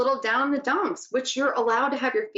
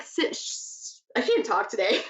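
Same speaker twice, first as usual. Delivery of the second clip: ordinary delivery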